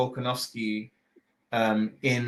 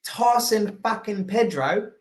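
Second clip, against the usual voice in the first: mock Aussie accent; 7 semitones higher than usual. Listing fighters for a friend, hammed-up Aussie accent. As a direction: ham up the Aussie accent for the ranking. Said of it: "we gotta do the accent".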